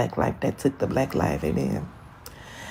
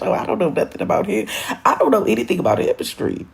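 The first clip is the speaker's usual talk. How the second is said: ordinary talk, nothing marked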